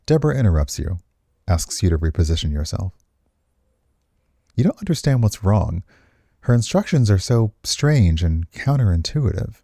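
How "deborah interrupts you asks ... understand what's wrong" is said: normal talking voice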